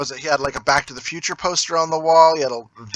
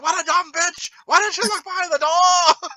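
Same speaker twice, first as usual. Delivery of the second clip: high-pitched mock rant at the screen; imitated TV yelling; 13 semitones higher than usual. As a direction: scold the film character like an outraged mom. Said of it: "she yells at the T V".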